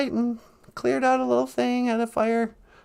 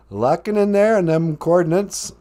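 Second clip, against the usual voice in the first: put-on deep voice; 8 semitones lower than usual.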